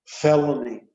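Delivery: ordinary delivery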